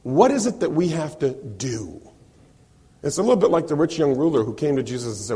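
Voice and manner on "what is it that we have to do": slow, each word leaned on; emphatic question. Shown as a demonstration. Preacher stressing the crowd's question, slow and emphatic. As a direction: lean on what we have to do. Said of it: "look at the emphasis".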